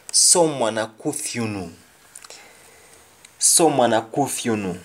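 Jamaican patois sentence, said twice; patois example repeated. Shown as a demonstration. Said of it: "Someone is looking for you".

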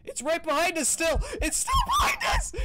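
high screaming, laughing in panic; being chased. Real, not acted. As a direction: shriek while running. Chased by ghost, shouting.

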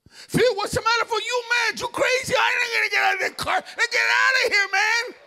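Nicky refusing to leave the car, frantic high yell; panicked Nicky. Retold or imitated. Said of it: "you go talk to some of the gang members".

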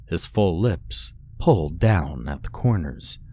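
usual speaking voice

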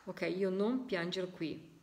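slow, stressing non; slow emphatic repeat. Shown as a demonstration. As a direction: lay out each word for listeners. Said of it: "Vedete come specifica bene". (lento, con enfasi su non)

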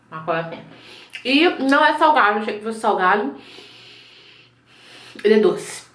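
talking while chewing; muffled chewing between words; mouth full, chewing. Real, not acted. Seen 4: Bites cracker at -1.0; lips move, closed chewing through labelled words.

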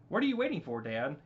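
high, loud, impatient question; impatient prodding. Acted. Son hurries his dad to get moving, impatient prodding. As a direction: pitch jumps up to hurry someone along.

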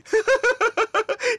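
high, loud burst of laughter. Acted. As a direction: cackle at the joke. Laughing at vet joke.

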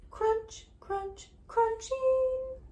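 sound demo. Shown as a demonstration. Teacher showing a child how to make the crunch sound. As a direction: model the noise for the child to copy. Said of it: "Can you make that sound?".

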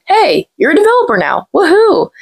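high, cheery pop-up announcement; mock celebration. Pop-up congratulates a new developer, mock-excited cheer. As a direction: announce it brightly, rising to a high whoop.

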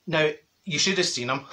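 ordinary delivery

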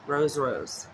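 ordinary delivery

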